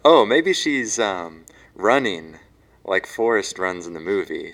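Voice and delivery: normal talking voice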